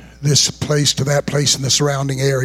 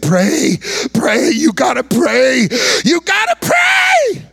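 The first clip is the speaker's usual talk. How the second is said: urgent plea climbing to a high scream; beg, each demand higher and more drawn out; desperate screaming plea; 10 semitones higher, 3 dB louder, slower than usual. Retold or imitated. Screaming patient begging the preacher to pray, building to a scream. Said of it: "watching this man screaming and thrashing".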